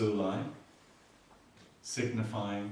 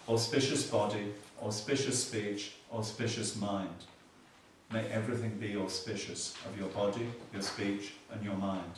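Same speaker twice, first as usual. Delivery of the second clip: ordinary talk, nothing marked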